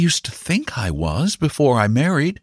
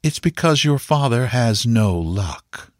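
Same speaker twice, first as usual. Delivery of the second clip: higher, slow voice with restrained bitterness; slow and bitter; 6 semitones higher, slower than usual. A narrator's reading. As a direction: let the blame out slowly. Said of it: "she said slowly and bitterly".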